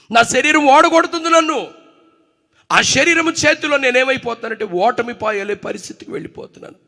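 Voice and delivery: fiery preaching. Acted. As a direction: hammer the questions home from the pulpit. Continues full-force preaching. (బోధకుడు సంఘాన్ని గట్టిగా ప్రశ్నిస్తూ ఉద్రేకంగా ప్రసంగం)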